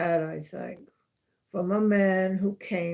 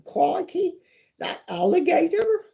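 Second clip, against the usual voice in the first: "Crikey" shot up much higher, excited Australian showman; hammed-up wildlife presenter voice, much higher; Steve Irwin impression; 12 semitones higher, 5 dB louder than usual. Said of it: "Steve Irwin used to say that".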